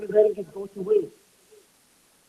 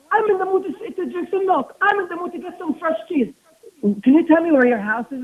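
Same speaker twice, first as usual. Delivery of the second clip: fake eager interest; 7 semitones higher, 4 dB louder than usual. Nosy man fakes friendly interest.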